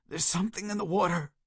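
high, strained terrified cry; terrified warning. A narrator's reading. Burgess warning Peters about the water, terrified cry. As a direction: warn him in terror. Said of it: "Burgess moaned".

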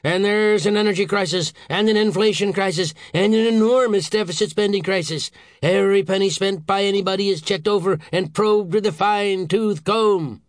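loud, high ranting list; exasperated boss on a tirade; angry howl. A narrator's reading. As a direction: pile up the crises in outrage. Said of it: "Saint George howled".

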